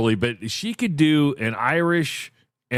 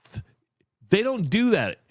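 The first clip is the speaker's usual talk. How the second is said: normal talking voice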